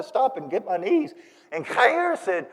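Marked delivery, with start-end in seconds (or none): none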